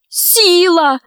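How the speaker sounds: high, imploring call; girl's plaintive appeal; pleading. A narrator's reading. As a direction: beg him in a high voice. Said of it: "взмолилась Алиса".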